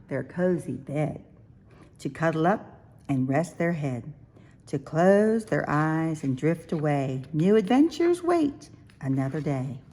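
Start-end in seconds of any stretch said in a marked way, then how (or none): none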